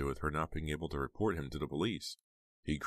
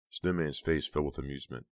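usual speaking voice

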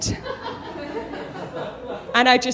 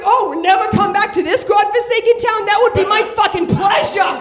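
put-on high, loud Boston accent sneer; sarcastic Boston accent; 9 semitones higher, 4 dB louder than usual. Comic answering bouncers in sarcastic Boston accent. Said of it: "I slip into a Boston accent".

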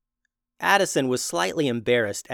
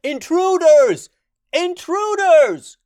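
high, loud, stretched-out shout; repeated alarm cry; 9 dB louder, slower than usual. Acted. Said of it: "repeating the cry".

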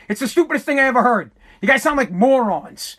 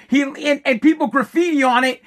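usual speaking voice

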